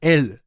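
エル said as a held L; English L demo. Shown as a demonstration. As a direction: show the consonant in the word. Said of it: "でもこれがエルの発音です". (舌を離さない「エル」)

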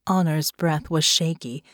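ordinary delivery, nothing marked

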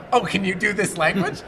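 casually asking an actor to speak French; mock breezy ask. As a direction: play the clueless producer asking lightly. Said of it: "sort of go".

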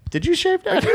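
very high squeaky question; high mock voice. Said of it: "They'd be like".

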